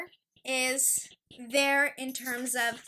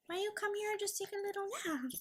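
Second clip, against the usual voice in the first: higher, softer and quicker pet voice; 7 semitones higher, 8 dB quieter, much faster than usual. Real, not acted. Owner coaxing dog Luna to nap, higher soft baby talk. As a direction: sweet-talk the puppy into a nap.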